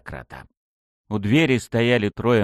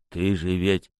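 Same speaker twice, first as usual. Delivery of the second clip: low, husky, unfinished question; slightly hoarse; 5 semitones lower, 3 dB quieter than usual. A narrator's reading. Goat-masked man turning on his accomplice, slightly hoarse. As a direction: lower it with a slight rasp, leave it hanging. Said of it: "хрипловато произнёс козёл".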